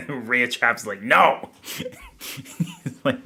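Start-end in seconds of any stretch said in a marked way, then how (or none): 1.0-1.5 loud, higher-pitched "no" outburst; mock refusal shout. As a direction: act out an emphatic chat refusal. Acts out chatter rejecting the idea.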